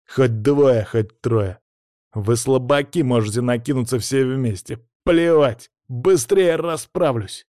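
cocky, mocking call-out; shouted taunt. A narrator's reading. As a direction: goad a whole gang into a fight. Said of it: "раскинул руки и крикнул".

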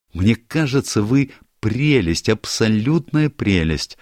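put-on gushing girl, drawn out; gushing young woman. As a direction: lay the compliment on thick, slowly. Barbara voiced by narrator.

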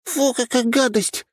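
very high-pitched little sister, slow; Nyusha's halting voice. A narrator's reading. Younger sister disgusted by her brother's state, halting high voice. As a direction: put on a squeaky high girl's voice, drawn out. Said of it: "прозвучал неподалёку прерывистый голос Нюши".

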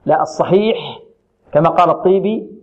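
ordinary talk, nothing marked

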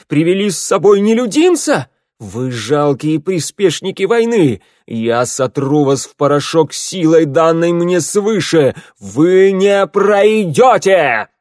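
high, loud furious roar; booming warrior king. A narrator's reading. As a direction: threaten them with fury. Enraged king threatens. (громовой гнев короля)

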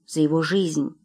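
ordinary delivery, nothing marked

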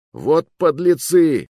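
медленный громкий возмущённый выкрик; выкрикни с возмущением. A narrator's reading. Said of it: "выкрикнул кто-то". (громкий возмущённый выкрик)

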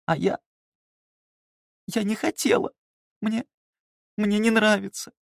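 high tearful wail; sobbing. Acted. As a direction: cry it out like a child. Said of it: "захлёбываясь слезами".

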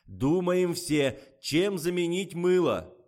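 slow, high, insistent shout; loud order. A narrator's reading. Director orders the crew to find a substitute, loud. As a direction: demand ideas from everyone deliberately.

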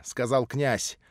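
plain reading voice

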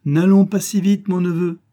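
sharp rebuke. A narrator's reading. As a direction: pull the hasty nephew up short.

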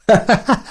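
high-pitched acted guffaw; helpless short burst of laughing; burst of laughter. A narrator's reading. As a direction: crack up after holding it in. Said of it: "захохотал".

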